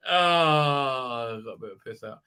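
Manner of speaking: exaggerated groaning release; mock relief after straining. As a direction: let the strain go in a hammed-up sigh. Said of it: "trying to take a piss".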